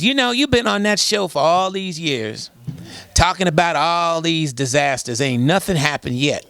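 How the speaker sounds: imitating a sassy woman caller, starting high and falling; caller with attitude. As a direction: challenge the host with sister attitude. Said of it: "she calls up with attitude".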